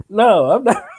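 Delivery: high, loud protest; retold emphatic objection; acted-out refusal. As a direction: refuse outright, voice jumping up. Refusing to touch the bones.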